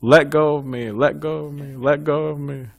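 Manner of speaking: half-playful yell of let go of me; retold mock shout, lightly voiced. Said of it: "he started yelling at the tree".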